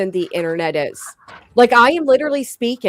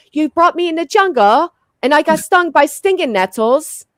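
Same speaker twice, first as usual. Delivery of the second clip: put-on higher-pitched English girl's accent; imitated English accent; 8 semitones higher, 4 dB louder than usual. Remembering her English childhood friend, imitated high English accent. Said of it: "her accent was just truly amazing".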